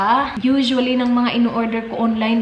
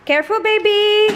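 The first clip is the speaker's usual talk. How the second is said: concerned surprise; 9 semitones higher, 4 dB louder than usual. Real, not acted. Reacts to toddler's fall.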